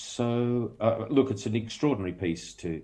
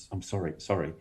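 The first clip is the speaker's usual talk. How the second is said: smiling stammered apology; 4 semitones lower, 4 dB quieter than usual. Retold or imitated. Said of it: "I actually smiled and went".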